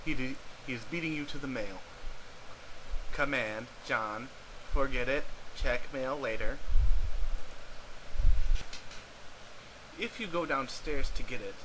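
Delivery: deadpan game narrator; story narration. A narrator's reading. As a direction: narrate the game with dry mock grandeur. Narrates the adventure.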